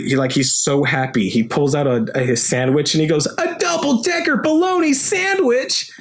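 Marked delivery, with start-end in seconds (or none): 3.4-5.9 high delighted squeal of joy; excited kid. Retold or imitated. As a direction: announce the sandwich like treasure.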